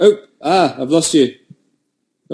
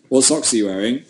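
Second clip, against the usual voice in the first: usual speaking voice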